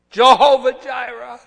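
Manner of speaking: crying out a name of God at full voice; loud cry. Acted. Preacher voicing David's cry, loud call.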